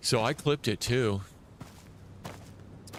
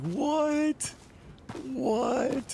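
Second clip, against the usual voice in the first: high, squeaky double what; startled what. Retold or imitated. Streamer replays his shocked reaction to the enemy, high and startled. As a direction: yelp it like you were caught off guard. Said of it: "That's what I said".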